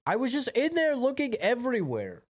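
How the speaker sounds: frustrated, pitch shooting up; exasperated high whine. Real, not acted. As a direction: whine it in disbelief. Frustrated searching, pitch jumps high.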